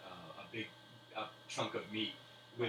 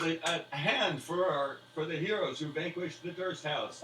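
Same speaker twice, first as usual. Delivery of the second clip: louder, higher call to raise a hand for the heroes; rousing toast; 7 semitones higher, 7 dB louder than usual. Acted. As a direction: hype up the crowd for the heroes. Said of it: "and he goes".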